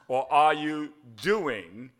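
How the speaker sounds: slow, loud, drawn-out question; slow demo question. Shown as a demonstration. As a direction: lay each word out slowly. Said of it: "Let me give you doing".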